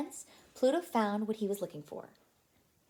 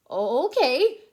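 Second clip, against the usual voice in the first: Mickey voice; 3 semitones higher, 10 dB louder than usual. Acted. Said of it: "said Mickey".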